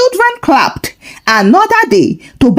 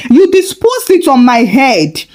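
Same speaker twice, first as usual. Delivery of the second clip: usual speaking voice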